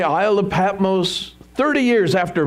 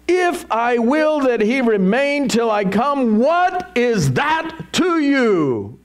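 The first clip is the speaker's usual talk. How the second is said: high, exasperated retort, drawn out at the end; 7 semitones higher, slower than usual. Acted. Jesus answering Peter about John, exasperated. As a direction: stretch the final question out, pitched high and fed up. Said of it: "the Lord is a little exasperated".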